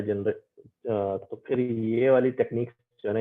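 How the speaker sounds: normal talking voice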